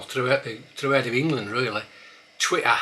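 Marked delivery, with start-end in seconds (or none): 2.4-2.8 Twitter with the T's swallowed as glottal stops. Shown as a demonstration. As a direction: say Twitter without the middle T. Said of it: "becoming common now to say".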